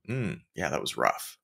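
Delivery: a coworker's low, soft verdict on a flop; unimpressed coworker. Retold or imitated. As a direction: let it drop low and quiet, unimpressed.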